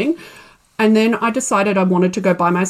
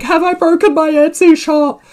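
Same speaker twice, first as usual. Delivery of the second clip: much higher, louder frantic question; panicked question; 7 semitones higher, 6 dB louder than usual. Retold or imitated. Seller googling her broken shop, louder, much higher and frantic. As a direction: fret out loud while madly googling. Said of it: "I'm like".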